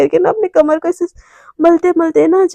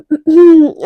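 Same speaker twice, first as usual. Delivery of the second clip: pointed, louder throat clear; demonstrated throat-clearing; 5 dB louder than usual. Shown as a demonstration. Romi spotting Ishki at dinner, pointed throat-clearing. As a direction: clear your throat meaningfully. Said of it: "ऐसे करने लगती है".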